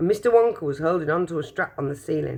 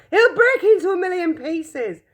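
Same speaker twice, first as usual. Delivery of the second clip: much higher, louder stammering; panicked shout; 13 semitones higher, 5 dB louder than usual. Acted. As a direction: stammer in alarm. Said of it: "shouted Grandpa Joe".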